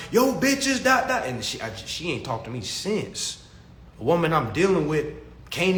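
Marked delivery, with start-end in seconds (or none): 0.1-1.2 high, loud mock-angry snap; mad retort. Angry woman firing back at him, loud and high. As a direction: fire it back at him, annoyed. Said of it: "she was mad".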